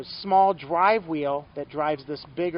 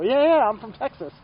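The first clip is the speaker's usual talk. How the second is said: louder, higher, excited yeah yeah; eager retold reply; 7 semitones higher, 9 dB louder than usual. Rider answering old shop owner, excited and loud. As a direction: burst out with happy agreement. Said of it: "And I go".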